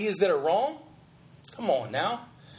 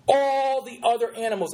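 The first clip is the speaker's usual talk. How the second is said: "all the other animals" punched out, pitched up from the start; stressed repeat; 3 semitones higher than usual. Shown as a demonstration. Host pointing out the preacher's emphasis, stressing "all the other animals".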